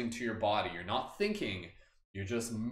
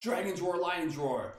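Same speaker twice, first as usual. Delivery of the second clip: roaring call; 6 semitones higher, slower than usual. Shown as a demonstration. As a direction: roar it out like a warrior. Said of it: "We're gonna do a big roar here".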